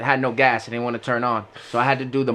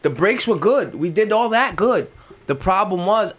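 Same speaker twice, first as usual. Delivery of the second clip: ordinary talk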